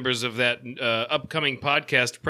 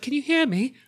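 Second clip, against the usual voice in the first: high-pitched imitation of a woman friend; mimicking Mercury; 11 semitones higher than usual. Relaying her question to Josh, high mimicry. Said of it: "Mercury's like".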